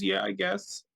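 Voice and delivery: high, reluctant yes on the edge of tears; mock tearful. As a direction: give in meekly, imitating someone about to cry. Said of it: "then just starts crying".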